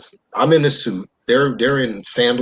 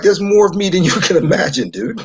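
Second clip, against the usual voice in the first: retold comeback, higher and exasperated; exasperated retort; 9 semitones higher, 3 dB louder than usual. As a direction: push back at them in a raised pitch. Retells his exasperated comeback to coworkers.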